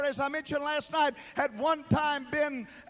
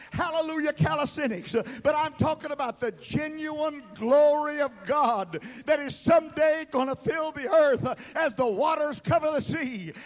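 usual speaking voice

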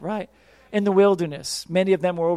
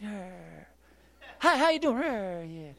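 mock growl; 7 dB quieter, slower than usual. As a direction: growl like a grouch. Imitating a growling grumpy person.

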